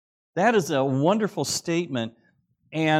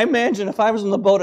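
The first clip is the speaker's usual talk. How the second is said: excited rush; 7 semitones higher, 9 dB louder, much faster than usual. Real, not acted. Excited aside after shouting.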